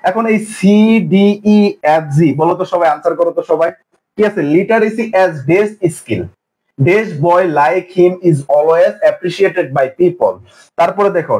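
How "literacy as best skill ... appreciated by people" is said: each word read out slowly; slow reading aloud. Shown as a demonstration. Teacher reading exam sentences slowly to the class.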